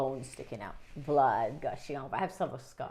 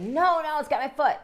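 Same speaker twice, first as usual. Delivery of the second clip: higher, louder pleading call; retold insistent yell; 10 semitones higher, 8 dB louder than usual. Upset boy insisting to family the fish has him, raised voice. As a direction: insist they listen, raised voice.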